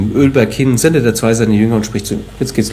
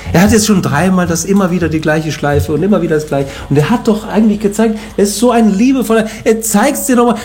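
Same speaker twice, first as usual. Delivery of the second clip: ordinary talk, nothing marked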